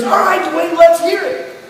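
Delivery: a cheerful doctor who expects good news; grinning doctor. Retold or imitated. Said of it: "he's grinning from ear to ear".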